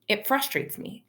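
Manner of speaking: plain reading voice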